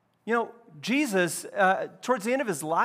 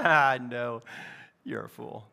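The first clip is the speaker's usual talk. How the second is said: low-pitched, dropping-off dismissal; dismissive verdict; 7 semitones lower, 3 dB quieter than usual. Acted. Reacting to someone foolish, low dismissive verdict. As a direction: sink low and quiet as you write them off.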